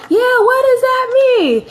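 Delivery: high-pitched mock mom voice; imitating mom. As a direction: pipe up like an insistent mother on speakerphone. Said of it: "My mom like".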